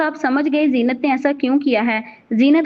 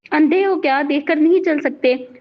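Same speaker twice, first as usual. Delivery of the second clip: ordinary delivery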